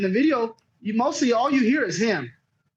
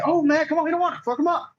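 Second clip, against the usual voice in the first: playful mock taunt; 6 semitones higher, faster than usual. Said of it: "if you would've said it in a funny manner".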